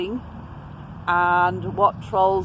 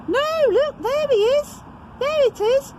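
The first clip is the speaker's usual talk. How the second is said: put-on very high, excited pirate girl's voice; excited cry; 3 dB louder than usual. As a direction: squeal it out, thrilled at the find. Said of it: "cried Peg Polkadot".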